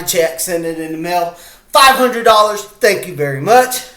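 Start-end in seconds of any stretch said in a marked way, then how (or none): none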